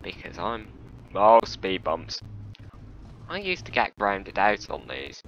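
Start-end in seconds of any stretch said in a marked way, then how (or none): 1.1-2.2 loud, drawn-out oh at the bumps; sudden alarm. Real, not acted. Driver spots speed bumps suddenly, loud and surprised. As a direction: react to the bumps with a jolt.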